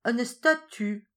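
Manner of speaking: put-on caught-out old woman naming what fell; embarrassed answer. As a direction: let it drop out reluctantly. She answers slowly, caught out.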